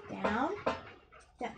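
ordinary talk

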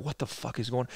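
whisper-like disbelief to your cousin; two kids murmuring in shock at a revival; hushed shock. Retold or imitated. Kids staring at aunt in tongues.

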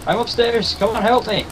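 high urgent shout for help; yelling across the room. Real, not acted. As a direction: call your teammate for help, high. Stunned player calling teammate.

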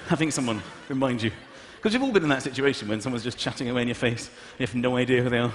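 normal talking voice

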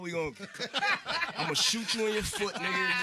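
half-laughing, hammed-up street threat; giggling through a mock threat; mock threat through laughter. Retold angry mixtape bars, laughing.

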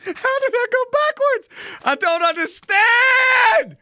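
high-pitched, rising cry of disbelief; high exasperated shout, stretched at the end; frustrated, baffled wail. Real, not acted.

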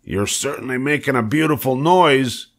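lightly voiced, annoyed sarcasm; mild snap. A narrator's reading. Old sheep scolds the wailing pig, mildly snappy. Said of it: "snapped the old sheep".

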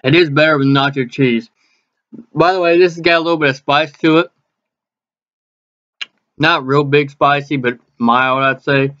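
speaking between bites; chewing while talking. Real, not acted. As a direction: mouth partly full of food. Seen 1: Bit taquito at -7; cheeks and lips look food-filled while talking.

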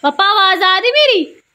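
high, very loud call to dad; loud shout. Real, not acted. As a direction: shout to check that father can hear. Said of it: "मैं जोर से बोल रही हूँ".

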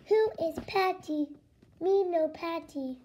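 stiff, even robot speech; monotone robot voice. Acted. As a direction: question it like a puzzled machine. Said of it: "I am a robot".

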